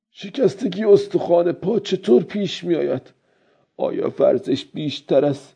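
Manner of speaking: high-pitched, wondering character voice; old fisherman pondering to himself. A narrator's reading.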